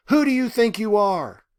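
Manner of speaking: roared. Acted. Ruby roaring at God in a final surge of fury. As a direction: roar it at the sky. The line read as a roar.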